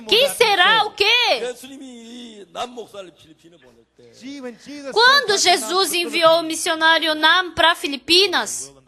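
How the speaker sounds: proclamação alta e elevada, cheia de fé; pregação com força. Acted. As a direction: declare com autoridade de pregador. (pastor declara com fé que ele não vai morrer)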